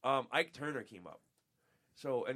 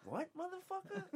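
outraged disbelief; 6 dB quieter than usual. Retold or imitated. Retelling his outraged reaction.

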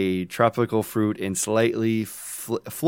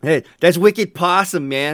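broad mock Boston accent, much higher and louder; 8 semitones higher, 8 dB louder than usual. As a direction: belt it out like a proud Bostonian showing off. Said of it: "I'm not quite, uh, Bostonian to make this sound better".

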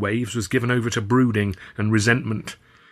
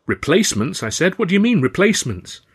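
a touch of shocked disbelief, pitched higher; 8 semitones higher, 4 dB louder than usual. Acted. Narrator stunned that Alf is sending replacements, pitched up. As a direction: throw the word back, higher and stunned.

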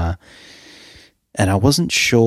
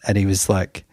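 ordinary delivery

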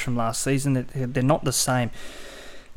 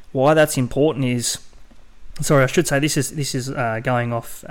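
usual speaking voice

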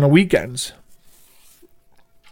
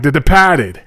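DEPARTED as "depahted", imitated Boston speech; Boston accent. Shown as a demonstration. Host practising his accent from the movie, Boston accent. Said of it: "work on my Boston accent".

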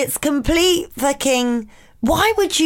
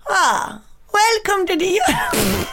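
put-on higher, botched Italian accent; 5 semitones higher, slower than usual. Cohost attempts Eurovision welcome, put-on Italian accent.

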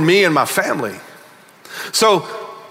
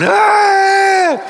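a high falsetto wail, sobbing while driving; mock sobbing wail; 12 semitones higher, 3 dB louder than usual. Pastor reliving crying on his four-wheeler, high wail. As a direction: cry out high like a man undone by tears.

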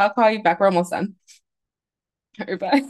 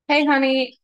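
sweet phone greeting; 7 semitones higher than usual. Real, not acted. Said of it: "sweet voice answering boyfriend's call".

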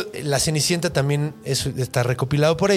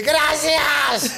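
high, squealed cartoon thanks; talking bread; 11 semitones higher than usual. Bread thanking the girl for rescue, comic high shout. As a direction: shout it high as a silly little creature. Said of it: "tiene una boca el pan".

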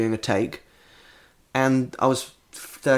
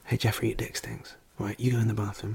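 hushed confrontation; 2 semitones lower, 10 dB quieter than usual. Acted. Telling Jeffrey to go in the bathroom, hushed. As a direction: break bad news under your breath.

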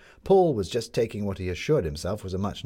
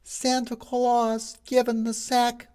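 high, slow street-hawker cry; newsboy crying the headline to passing crowds; newsboy shouting; 4 dB louder, much slower than usual. A narrator's reading. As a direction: call it out like a paper boy selling headlines.

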